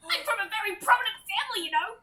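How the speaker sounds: high, loud, indignant little boast; entitled recruit. Acted. Pampered recruit protests to superior officer, high entitled voice. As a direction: pipe up high with self-important protest.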